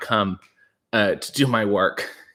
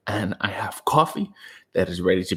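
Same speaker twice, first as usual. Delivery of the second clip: whisper; 5 semitones lower, 5 dB quieter than usual. Acted. Whispered aside in morning vlog.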